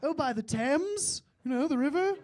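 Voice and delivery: high, loud, slow fake British; British accent. Teen bluffing about where he lives, loud fake British accent. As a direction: stretch out a hammed-up British accent. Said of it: "randomly applied in a British accent".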